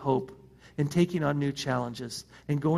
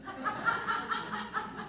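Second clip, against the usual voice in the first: a sudden high laugh, then softer; 8 dB quieter than usual. Real, not acted. Pastor laughing at his old high school boast.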